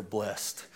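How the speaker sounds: blessed said as "blest"; "blessed" with a silent e; one-syllable blessed. Shown as a demonstration. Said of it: "it's the same word for".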